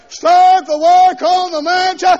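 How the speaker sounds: slow, high, loud mock command; God as foreman. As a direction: order the builders back to work. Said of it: "Put his name back down in there".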